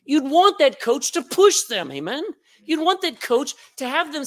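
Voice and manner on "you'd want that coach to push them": ordinary talk, nothing marked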